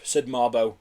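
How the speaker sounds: plain reading voice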